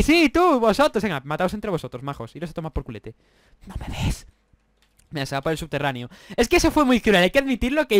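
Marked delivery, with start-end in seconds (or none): 3.7-4.3 sneaky whisper. Acted. Gamer hiding from the other players, whispering.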